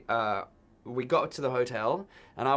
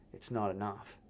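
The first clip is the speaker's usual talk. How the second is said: much softer, low threat; quiet menace; 3 semitones lower, 8 dB quieter than usual. Retold or imitated. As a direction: say it cold and softly like a gunman. Gunman threatening him.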